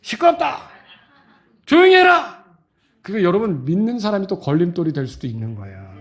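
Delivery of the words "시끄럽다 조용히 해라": a hint of a scolding crowd, pitched high; rebuking crowd. Followers shushing the beggar, mild scolding rebuke. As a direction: hush him up with scorn. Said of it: "뭐라 그러죠".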